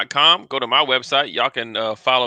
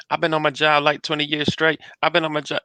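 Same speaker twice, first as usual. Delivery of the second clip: higher-pitched rapper impression; 4 semitones higher than usual. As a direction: imitate a rapper's high-pitched voice. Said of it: "is it the [name] voice".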